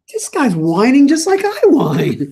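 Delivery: high, slow whiny complaining; mock whine. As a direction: whine it like a hurt child. Mock whining quote.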